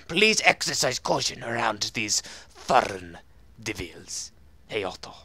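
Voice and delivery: put-on note-reading voice, sinking at the end; character voice. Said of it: "I need to do this in a voice".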